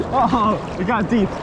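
normal talking voice

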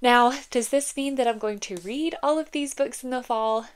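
normal talking voice

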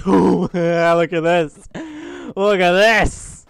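slow, drawn-out delight. Real, not acted. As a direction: savour it, amused and thrilled. Gleeful at crouch animation.

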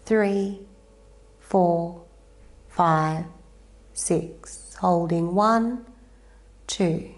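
slow, even counting of each breath. Acted. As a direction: count the breaths calmly, one by one. Yoga teacher counts breaths.

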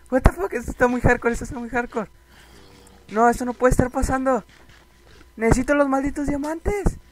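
high, loud, overwhelmed protest; distressed outcry. Real, not acted. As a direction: wail that it's all going wrong. Agobiado por el ataque. (protesta angustiada, aguda y fuerte)

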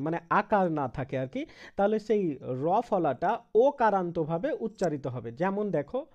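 usual speaking voice

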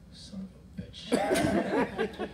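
high, slow drawn-out curse; mock-despairing wail; exasperated groan. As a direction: stretch the curse in defeat. Frustrated exclamation, drawn out.

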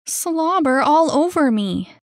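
high, loud and grossed out; disgusted complaint. Acted. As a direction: protest in indignant disgust. Annie indignant about the kiss.

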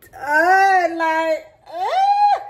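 high loud joyful cry; high, loud, thrilled; excited shout. Real, not acted. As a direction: shout it out with glee. Excited hair reveal.

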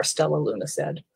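usual speaking voice

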